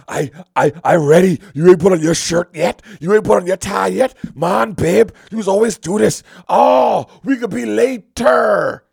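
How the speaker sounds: mock huffy complaint. Husband nagging wife about her lateness, loud mock whine. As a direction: huff up the voice at babe.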